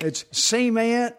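high, slow Mississippi drawl; Mississippi accent. Retold or imitated. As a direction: stretch out "cement" in a playful Mississippi accent. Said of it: "You can stretch it out".

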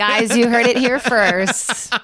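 slow, loud, laughing host voice; laughing announcement. Pretend. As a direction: declare it through laughter.

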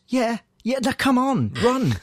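imitating Rey's offhand hurry; impatient Rey. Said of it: "looks at him and goes".

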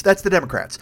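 ordinary talk

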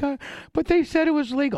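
high mock-whiny protest. Imitating his girlfriend protesting on the plane, high and whiny. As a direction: whine it like a scared girl. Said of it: "She's like".

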